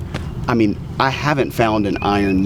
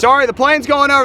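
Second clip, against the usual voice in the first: raised voice over plane noise; repeating over loud noise; 5 dB louder than usual. Real, not acted. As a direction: speak up over the engine. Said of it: "You gotta speak up a little bit".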